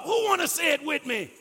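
high, quick shouted invitation; rally the congregation to join in; rousing shout. Real, not acted. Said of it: "Like we want him to show up".

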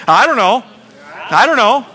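showing the church how to say it; shrugging I don't know. Shown as a demonstration. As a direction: really ham up the clueless shrug. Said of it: "Just really get into it".

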